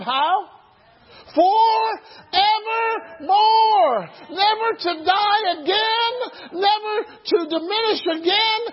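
high, loud preaching shout; preacher shouting Christ's promise to the crowd; full-force preaching. Acted. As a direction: preach it at the top of your voice.

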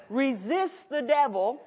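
ordinary talk, nothing marked

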